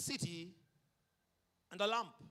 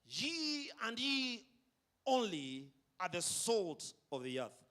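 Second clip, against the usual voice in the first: "ye and ye only" drawn out slow, high on the first "ye"; stress on ye only; 4 semitones higher, 4 dB louder than usual. Shown as a demonstration. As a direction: lean hard on "ye" and "only". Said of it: "can best be restated as follows".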